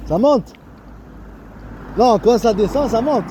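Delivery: loud, high joking call. Real, not acted. Teasing passers-by about business, called out loud and high. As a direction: shout it across to them. Calling out to people nearby.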